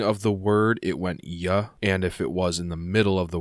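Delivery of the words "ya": "ya" starting with a Y sound. Shown as a demonstration. Explaining an initial J, "ya" said with a Y. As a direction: say "ya" with a Y glide. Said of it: "if the J is at the beginning".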